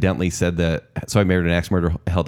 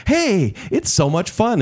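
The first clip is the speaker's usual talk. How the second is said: high, slow, gushing delight; giddy fun; 10 semitones higher, slower than usual. Retold or imitated. Host quoting the ad's actors, high and slow and gushing. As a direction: gush like a kid in a toy ad.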